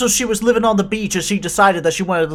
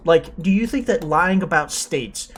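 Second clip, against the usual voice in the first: usual speaking voice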